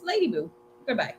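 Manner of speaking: normal talking voice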